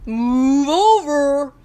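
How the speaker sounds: loud, slow mooing command; long drawn-out cow moo on the first word; bossy cow order. A narrator's reading. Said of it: "ordered Bossy Cow".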